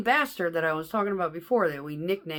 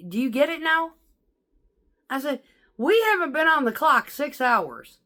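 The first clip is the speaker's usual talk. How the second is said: fed-up berating; 8 semitones higher, 6 dB louder than usual. Retold or imitated. Driver berating a whining coworker over the radio, loud and fed up. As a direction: bark it down the radio at him.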